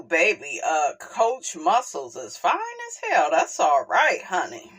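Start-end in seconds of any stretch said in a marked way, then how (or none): none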